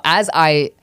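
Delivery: ordinary delivery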